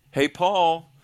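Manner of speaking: calling out. Pretend. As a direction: pretend to get someone's attention. Suggests a simple call to Paul.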